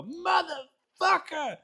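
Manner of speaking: very high, slow, stretched Motherfucker; retold celebration shout. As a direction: bellow it like the goal just went in. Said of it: "you see me go".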